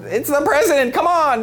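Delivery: high-pitched, pleading protest; incredulous insistence. Retold or imitated. Comic telling how the president must be indulged, incredulous insistence. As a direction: insist as if it should be obvious.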